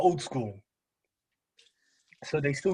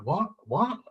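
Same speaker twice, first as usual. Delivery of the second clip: retold baffled what; 5 semitones higher than usual. Retells not understanding McDonald's staff.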